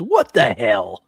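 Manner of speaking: lightly voiced slow little-kid exclamation; a toddler copying a swear, drawn out slowly.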